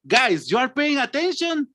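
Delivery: high-pitched questioning call to students; acted teacher's query; teacher checking class. Shown as a demonstration. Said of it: "I get angry when the students don't pay attention".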